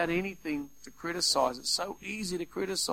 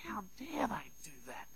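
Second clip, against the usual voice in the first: hissed "how dare they", far softer; angry whisper; 4 semitones higher, 14 dB quieter than usual. Retold or imitated. Preacher voicing the resentful one's inner seething, whispered. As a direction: seethe it under the breath.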